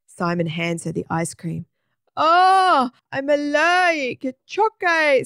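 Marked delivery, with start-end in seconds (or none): 2.1-5.2 high, loud wail round a huge tongue; swollen-tongue voice. A narrator's reading. Woman with a swollen tongue to Simon, garbled wail. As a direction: garble the words as if your tongue fills your mouth.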